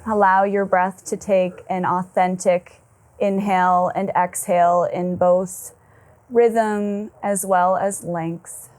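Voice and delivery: slow, settled meditation voice; guided meditation. Acted. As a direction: ease them inward with long, calm pauses.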